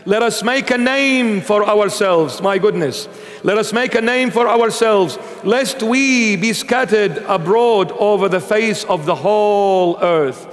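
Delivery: normal talking voice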